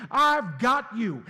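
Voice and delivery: high, loud, slow promise; shouted reassurance. Acted. Pastor voicing God shouting to the grieving, loud and slow. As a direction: shout it over the crowd.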